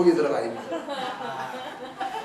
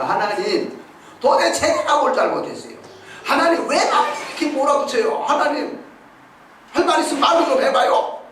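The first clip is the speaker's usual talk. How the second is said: higher, pleading, indignant questions; complaining to God; 8 semitones higher than usual. Retold or imitated. David, driven out by his son, protesting to God. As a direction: demand answers from God, hurt and protesting. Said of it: "하나님 앞에 그렇게 따질 만도 한데".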